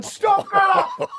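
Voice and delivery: high, slow drawn-out call through laughter; half-laughing shout at the girl on the green; laughing yell. Retold or imitated. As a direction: holler her name as the cart rolls on. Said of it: "All the boys are going".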